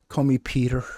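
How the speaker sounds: quick, hammed-up Irish priest accent; a deliberately bad Irish accent, imitating the priest; mock Irish accent tossed off with a laugh. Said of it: "That was horrible accent".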